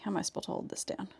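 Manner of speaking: ordinary talk, nothing marked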